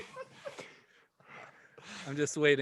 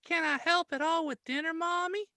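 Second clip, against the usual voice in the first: put-on high sweet girlish greeting; little girl voice; 3 dB louder than usual. Daughter greets her father coming home, high little-girl voice. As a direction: play the sweet eleven-year-old.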